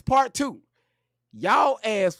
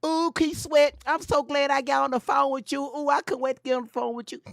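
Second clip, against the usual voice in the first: higher gushing fan voice; excited female caller; 6 semitones higher than usual. Retold or imitated. A fan calling the radio host, higher and gushing. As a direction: swoon like a caller who got through. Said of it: "caller gushing to DJ".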